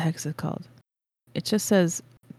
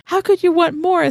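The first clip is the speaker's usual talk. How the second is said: baffled brother; 12 semitones higher, 4 dB louder than usual. Retold or imitated. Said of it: "I'm like".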